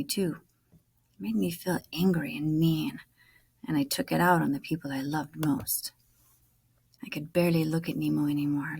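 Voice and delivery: usual speaking voice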